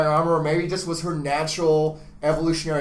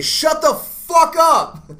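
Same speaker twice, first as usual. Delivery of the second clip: slow, much higher, louder shout; retold yell; 13 semitones higher, 7 dB louder, much slower than usual. Boyfriend reenacting yelling at his girlfriend, slow loud shout. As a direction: bellow it out slowly like you mean it. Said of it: "so I say".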